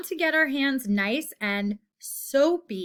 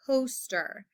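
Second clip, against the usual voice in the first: ordinary talk, nothing marked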